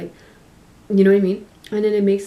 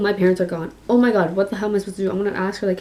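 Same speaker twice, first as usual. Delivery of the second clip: ordinary delivery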